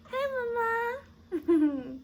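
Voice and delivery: high, very slow cooing with a laugh. Real, not acted. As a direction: stretch it out lovingly, laughing. Cooing at the baby.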